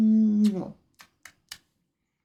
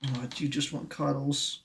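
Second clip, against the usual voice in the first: ordinary talk, nothing marked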